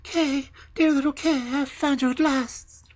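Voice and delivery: put-on high, loud girl's cry of joy. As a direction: call out to him, overjoyed.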